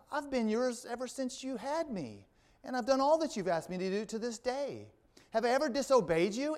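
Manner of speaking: high-pitched, lightly acted appeal; reasoning. Donkey reasons with its master Balaam, high, pleading protest. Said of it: "the donkey reasons with him".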